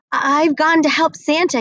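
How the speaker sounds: a lightly voiced eager young reindeer. Rudolph's note voiced in story.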